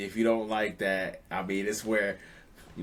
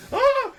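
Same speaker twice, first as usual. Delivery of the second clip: sudden high shriek of fright; startled scream; 10 dB louder than usual. Retold or imitated. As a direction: shriek like a scared king. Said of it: "and he like".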